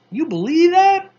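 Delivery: high, loud disbelief; incredulous outburst. Acted. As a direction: blurt out how unbelievable it is. Mars Bar stunned, outraged.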